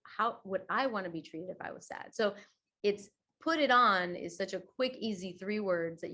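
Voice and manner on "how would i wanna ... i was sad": gentle inner thought. Retold or imitated. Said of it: "it becomes".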